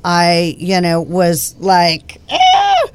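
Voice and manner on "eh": high squeaky "eh", then whatever; exaggerated verbal shrug; dismissive. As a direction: brush it off with a mock scoff. Acts out own shrugging reaction.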